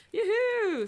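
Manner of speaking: high, loud whoop of delight; excited cheer. Real, not acted. Thrilled painter cheering over her new magnetic paint, loud whoop. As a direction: whoop it out happily.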